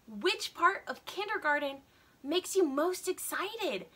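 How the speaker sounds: ordinary delivery, nothing marked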